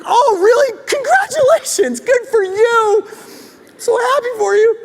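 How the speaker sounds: sarcastic over-sweet praise; mock sarcastic congratulations. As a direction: gush it with obvious insincerity. Imagined fake congratulations.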